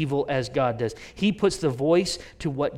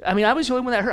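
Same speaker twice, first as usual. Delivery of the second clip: usual speaking voice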